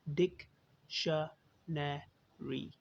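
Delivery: डिक्शनरी very slowly in four separate beats, डिक-श-ने-री; syllable by syllable. Shown as a demonstration. Teacher counting the parts of a word, डिक्शनरी syllable by syllable. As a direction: break डिक्शनरी into डिक, श, ने, री with pauses. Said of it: "चार हिस्से यानी चार syllable".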